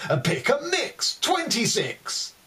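high slow bingo call; bingo caller. Pretend. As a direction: sing out the number to the hall. Bingo calls in poem.

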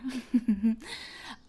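a sly little laugh. Acted. Laughing after teasing the guest, sly. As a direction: laugh it off slyly.

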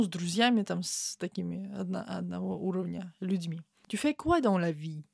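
ordinary talk

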